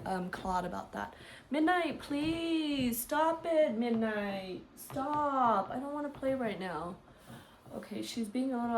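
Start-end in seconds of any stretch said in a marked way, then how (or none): 1.5-6.9 scolding pet. Real, not acted. Telling cat to stop biting.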